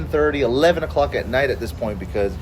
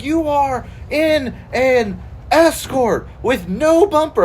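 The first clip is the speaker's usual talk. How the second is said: mock angry dad; 9 semitones higher, slower than usual. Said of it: "he was so pissed".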